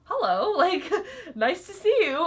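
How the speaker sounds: high, loud, laughing fake politeness; sarcastic greeting. Clerk answering a rude guest with high sarcastic cheer. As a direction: greet her with sarcastic sweetness.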